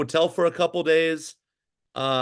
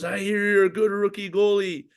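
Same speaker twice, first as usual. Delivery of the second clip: put-on heavy French Canadian accent, pitched higher; 5 semitones higher than usual. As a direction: imitate the Quebec coach with a thick French Canadian accent. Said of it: "in this heavy French Canadian accent".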